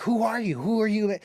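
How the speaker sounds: high, eager imitated fan question; excited fan. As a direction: mimic a fan begging to know. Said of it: "they would be like".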